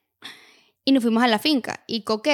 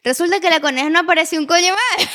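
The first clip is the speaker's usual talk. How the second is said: más fuerte y más agudo, indignada; remate indignado; 5 semitones higher, 5 dB louder than usual. Real, not acted. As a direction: remata el cuento subiendo la voz. Remate indignado del cuento. (remate del cuento, alto y agudo)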